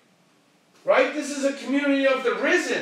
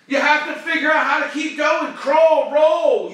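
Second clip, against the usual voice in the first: loud, urgent rallying cry; fired-up shout; 5 semitones higher, 7 dB louder than usual. Real, not acted. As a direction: shout them forward, don't let them stop. Urging listeners never to quit.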